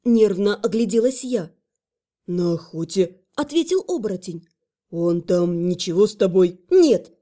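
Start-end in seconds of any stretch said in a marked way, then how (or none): 2.3-6.6 low man's voice; low male voice. Acted. Werewolf answers. 6.7-7.1 loud, sharp exclamation; exclaiming. Acted. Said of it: "воскликнула я".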